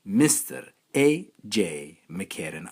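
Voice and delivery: slow, loud ring announcer; big grand introduction. Acted.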